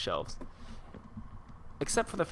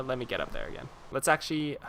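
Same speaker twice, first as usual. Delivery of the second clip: ordinary talk, nothing marked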